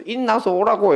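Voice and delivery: put-on impatient teacher calling a confused kid forward in Gyeongsang dialect; exasperated teacher. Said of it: "애가 어쩔 줄을 몰라가지고 ... 당황을 하더라고요".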